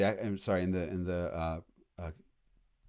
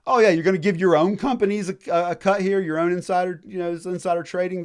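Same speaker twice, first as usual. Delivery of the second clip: high, loud mock outrage; mock indignant colonist; 9 semitones higher, 8 dB louder than usual. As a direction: sneer it like an offended colonist. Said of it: "And the colonists go".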